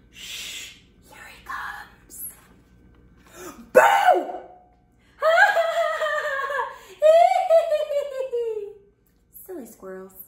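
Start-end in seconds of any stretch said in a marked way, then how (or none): 0.1-2.2 hushed unvoiced whisper. Hiding before a surprise. 3.7-4.3 very high, loud boo; playful shout. Acted. Jumping out to surprise. 5.2-8.7 loud, high burst of giggles; high delighted laughter. Acted. Laughing after surprise.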